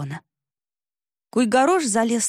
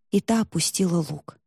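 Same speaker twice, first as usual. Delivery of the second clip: usual speaking voice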